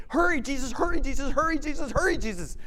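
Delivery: high, loud, repeated hurrying plea; a desperate father pushing Jesus to hurry; frantic urging. Retold or imitated.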